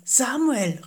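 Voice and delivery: a hint of an unseen voice calling; lightly voiced night voice; mysterious call. A narrator's reading. The voice calls a third time.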